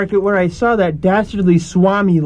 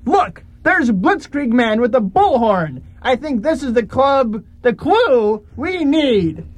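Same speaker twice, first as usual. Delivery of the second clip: ordinary talk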